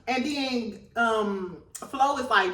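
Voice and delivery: usual speaking voice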